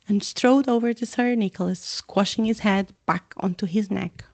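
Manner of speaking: plain reading voice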